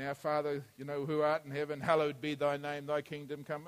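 rattled off quietly, by rote; rote recital. Shown as a demonstration. Preacher mocking a rote prayer, mumbled without meaning. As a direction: mumble the prayer like an empty habit. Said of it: "We say the thing over and over again".

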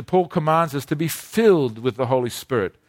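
usual speaking voice

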